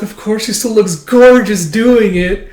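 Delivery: high, loud, slow swoon; acted fangirl gushing; gushing admiration. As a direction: gush over her, drawing words out. Voicing an admiring assistant character.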